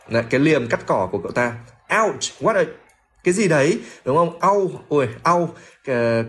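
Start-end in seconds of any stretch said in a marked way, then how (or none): 2.0-6.2 mock ouch. Voicing Ron being hit.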